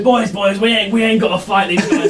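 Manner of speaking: captain calling off his men. Acted. Charmed captain telling his friends not to fight. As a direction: wave your boys down. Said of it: "turns to his friends and goes".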